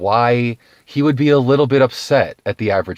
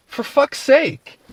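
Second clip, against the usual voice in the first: exasperated; much slower than usual. Real, not acted. Exasperated outburst.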